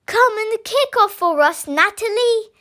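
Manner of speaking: a mild, high shout from the team. A narrator's reading. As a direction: players call her onto the field. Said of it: "they shouted".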